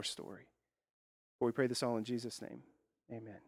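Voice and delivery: hushed opening prayer to the congregation. Real, not acted. Opening prayer before sermon.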